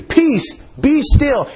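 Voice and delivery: high, emphatic command with falling punch on each phrase; exclaimed rebuke. Shown as a demonstration. Preacher showing how Christ rebuked the storm, exclaimed command. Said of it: "and it should be".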